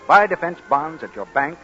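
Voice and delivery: ordinary talk, nothing marked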